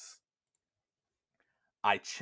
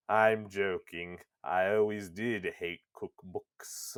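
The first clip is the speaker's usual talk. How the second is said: Weiss; 2 semitones lower than usual. Acted. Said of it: "he turns around and goes".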